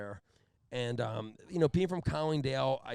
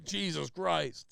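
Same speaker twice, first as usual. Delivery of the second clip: disgusted curse; 4 semitones higher, much slower than usual. Retold or imitated. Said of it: "He didn't even laugh".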